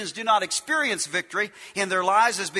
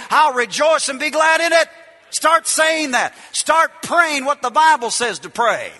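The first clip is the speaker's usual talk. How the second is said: louder, rising preacher's build; preaching; 5 semitones higher, 7 dB louder than usual. Acted. Preacher urging believers to declare victory, rousing.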